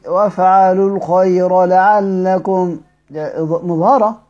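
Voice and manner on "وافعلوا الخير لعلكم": slow recitation of وافعلوا الخير with a clear lam. Shown as a demonstration. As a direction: keep the lam of وافعلوا distinct before الخير. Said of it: "دي مظهرة".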